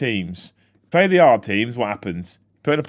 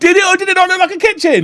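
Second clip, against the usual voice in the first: much higher and louder, hammering it; insistent repeat; 8 dB louder than usual. Real, not acted. Co-host repeating the question, higher, louder and insistent. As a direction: demand the answer again, pushier.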